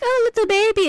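high-pitched baby-talk imitation; mock cooing witch. Witch looking at the baby, mock cooing. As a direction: coo it high, as if at a pram.

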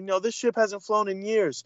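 lightly acted high-pitched reply; quoted movie heroine. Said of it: "She's like".

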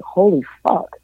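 high, amazed exclamation rising further; retold amazement. Wrestler walks into Texas show, replaying stunned amazement. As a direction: replay the shock of walking in. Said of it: "and I was like".

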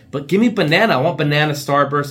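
put-on high, loud childish demand; kid whining for candy. Grown man whining for banana Starburst like a kid. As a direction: beg like a bratty kid.

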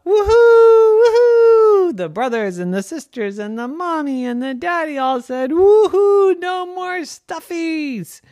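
high, loud whooping celebration; the whole family cheering at once. Acted. Famille qui crie de joie.

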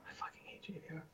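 whispered venom; hissed whisper. Real, not acted. Fan whispering his hatred of a player, hissed. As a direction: whisper it with disgust.

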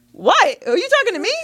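high-pitched, acted disbelief; retold incredulity. Storyteller answering the heckler, mock incredulous. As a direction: act out an affronted comeback, pitch up.

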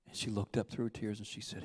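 ordinary talk

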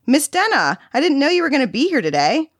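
put-on high-pitched child's voice, happily surprised; excited little boy. A narrator's reading. Boy greeting Miss Denna on her visit, excited. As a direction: chirp it like a delighted young kid.

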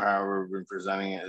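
normal talking voice

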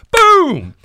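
sudden very loud high boom; shouted boom. Retold or imitated. Teller shouts out the punch landing on Maxie.

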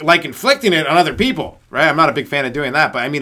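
usual speaking voice

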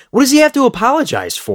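high, indignant real outburst; worked up. Real, not acted. Venting about Ross having to apologize, high indignant outburst. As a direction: let the question fly up high in disbelief. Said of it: "I get so worked up".

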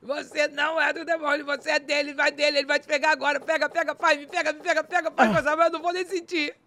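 high, loud, urgent rush of words; acted inner screaming. Screaming in her mind as he chases, high frantic cries. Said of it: "comecei a gritar dentro assim da minha mente".

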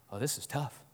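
very soft, low muttering to himself; hushed aside to himself; worried private thought. Acted. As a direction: think it aloud, barely voiced. Boudreaux's worried private thought in joke.

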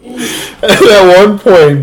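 can barely get the story out for laughing; giggling confession, words slowed by laughs; laughing through it. Real, not acted. Laughing while telling prank story.

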